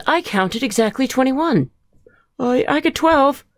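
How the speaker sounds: high, loud storybook voice; eager character voices. Acted. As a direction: report your count eagerly. Characters call out their counts.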